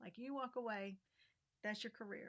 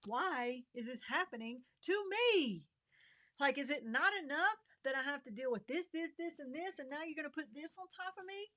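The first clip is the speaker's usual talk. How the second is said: higher and louder, pleading outcry; rising anguish; 6 semitones higher, 7 dB louder than usual. Real, not acted. Widow venting to God, loud anguished outcry. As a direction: let the frustration burst out at the unfairness. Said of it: "you start thinking".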